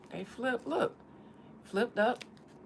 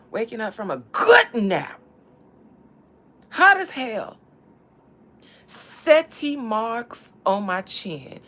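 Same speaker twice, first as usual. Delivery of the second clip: loud reminiscing; 3 semitones higher, 11 dB louder, slower than usual. Pretend. Loud nostalgic outburst.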